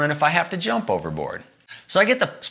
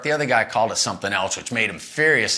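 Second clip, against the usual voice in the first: ordinary talk, nothing marked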